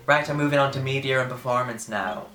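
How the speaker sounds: put-on Irish announcing voice; Irish lady calling out. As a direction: call it out like an Irish organiser. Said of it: "this Irish lady's calling out".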